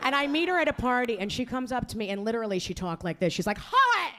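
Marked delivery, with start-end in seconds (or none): 3.6-4.1 high-pitched caricature of affected talk; mock a materialistic girlfriend's weird way of talking; weird jappy talk. Friend's girlfriend greeting her at a party, affected. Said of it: "she talks weird. She's very jappy".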